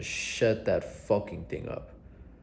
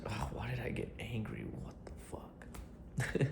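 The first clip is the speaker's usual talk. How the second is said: hushed, much softer, frustrated whisper to himself; 15 dB quieter, slower than usual. Retold or imitated. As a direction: murmur it under your breath, annoyed at yourself. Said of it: "And I'm just like".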